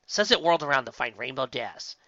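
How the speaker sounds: ordinary delivery, nothing marked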